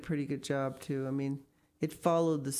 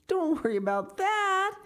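laughing; 5 dB louder than usual. A narrator's reading. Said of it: "he started laughing again".

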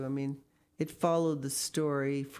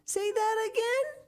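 very high excited urging; eager demand. A narrator's reading. Friends press Miss Pinchin to repeat, high eager demand. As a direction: urge her on in a high voice. Companions startled by the magic words.

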